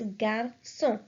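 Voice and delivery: "garçon" in two parts, gar-SON, with an S sound. Shown as a demonstration. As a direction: break "garçon" into syllables, ç said as S. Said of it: "it changes the sound of C to S".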